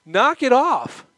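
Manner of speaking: Paul rebuking the weeping friends; rebuke. Retold or imitated. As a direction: stop them short, scolding. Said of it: "he's rebuking them".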